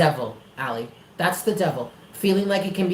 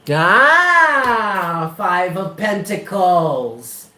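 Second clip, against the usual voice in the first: ordinary talk, nothing marked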